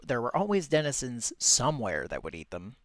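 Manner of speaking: ordinary delivery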